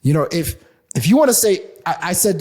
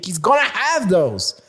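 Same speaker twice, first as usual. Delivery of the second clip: ordinary talk, nothing marked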